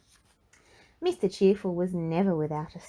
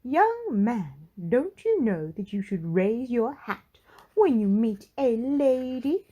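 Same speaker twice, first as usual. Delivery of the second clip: higher, louder prim lady's voice, slowing on the end; Little Miss Splendid scolding; 5 semitones higher, 4 dB louder than usual. Acted. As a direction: lecture him like a stern prim lady. Miss Splendid scolds Mister Cheerful.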